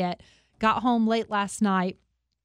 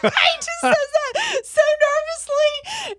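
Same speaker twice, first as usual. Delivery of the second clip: loud, high squeal of amusement; helpless high-pitched hilarity, words stretched out; cracking up; 6 dB louder than usual. Real, not acted. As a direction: squeal it out through real laughter. Cracking up at retold quote.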